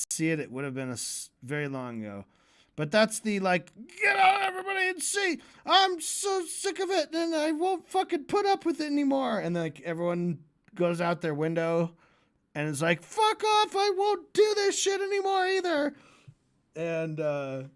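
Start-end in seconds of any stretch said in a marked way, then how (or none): 4.0-9.4 high, strained yelling rant; high-pitched ranting scream; ranting shout. Retold or imitated. Radio rant quoted, screaming. 13.0-15.9 high, shouted out the window; high, hammed-up screaming from a window; mock scream. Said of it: "all these people are just screaming".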